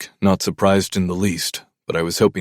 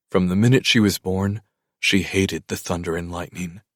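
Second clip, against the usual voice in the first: ordinary delivery, nothing marked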